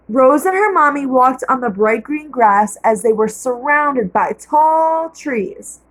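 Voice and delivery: ordinary delivery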